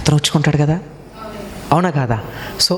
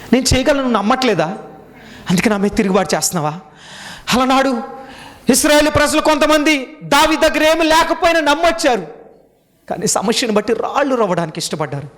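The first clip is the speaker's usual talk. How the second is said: preaching at full force; 9 semitones higher than usual. Acted. Pastor voices God challenging the congregation, preaching at full force. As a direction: hammer the question at the listener.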